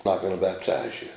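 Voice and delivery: low, soft flat refusal; a quiet, dismissive brush-off from the preacher. Retold or imitated. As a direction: mutter it low like a curt brush-off. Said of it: "You know what his preacher told him".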